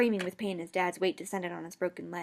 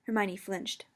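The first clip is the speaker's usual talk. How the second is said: plain reading voice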